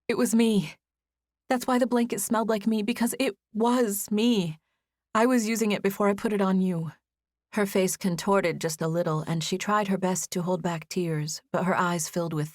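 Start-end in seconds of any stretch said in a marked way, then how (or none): 0.1-6.9 put-on higher, earnest young woman; a woman admitting the truth. Narrator voices Izzy, confessing.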